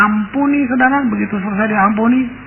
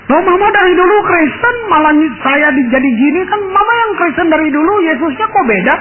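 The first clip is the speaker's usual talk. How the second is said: much higher, incredulous retort; indignant surprise; 7 semitones higher than usual. Retold or imitated. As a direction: throw the question back in disbelief. Daughter protests to her mother.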